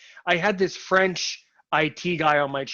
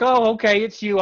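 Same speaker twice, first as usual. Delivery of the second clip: higher, louder retold realization; retold relief; 6 semitones higher, 6 dB louder than usual. Blind friend recognizing the caller on the phone, relieved. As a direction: imitate him suddenly recognizing the caller.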